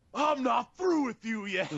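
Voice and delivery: high, drawn-out defiant call; loud, slow imitation of a defiant wrestler; mock menacing yell. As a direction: stretch out a mock wrestler's threat. Wrestler's defiant line retold.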